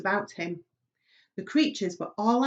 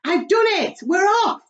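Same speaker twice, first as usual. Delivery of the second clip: high, loud gleeful cry; triumphant shout; 12 semitones higher, 10 dB louder than usual. Acted. Centipede shouting through the ceiling hole, triumphant. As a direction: crow with triumph. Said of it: "grinning face ... and shouted".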